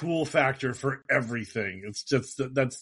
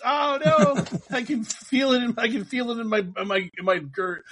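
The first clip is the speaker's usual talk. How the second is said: high, laughing hammed-up alarm; cartoonish fake distress that settles down; mock panic; 8 semitones higher than usual. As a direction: pretend dread, giggling through it. Hamming up the cursed move.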